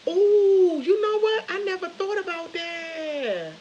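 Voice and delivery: very high, drawn-out surprise; sudden realisation. Real, not acted. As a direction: burst in high, then stretch it out slowly. Sudden realisation of something new.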